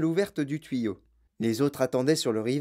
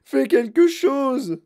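high, loud, drawn-out cry; shrieked slow order; frantic demand; 11 semitones higher, 7 dB louder, much slower than usual. A narrator's reading. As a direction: yell desperately at your husband to act. Wife urges husband to rescue son.

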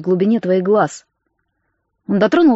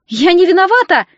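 high, raised-voice protest; squealed denial; 7 semitones higher, 4 dB louder than usual. A narrator's reading. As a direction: squeal it out defensively. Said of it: "взвизгнула она".

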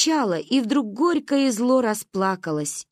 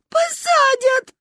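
very high, loud echoing bawl; wailing agreement; 5 dB louder, much slower than usual. A narrator's reading. Girl agrees Ba will be jailed, long high wail. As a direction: join the lament, stretching the word. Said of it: "соглашалась я".

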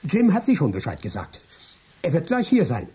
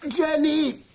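much higher, desperate protest; frantic; 13 semitones higher than usual. Acted. Trapped Michael shouting to his wife, frantic and high. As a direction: break in, panicked, to warn her.

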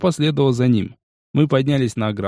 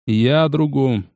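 usual speaking voice

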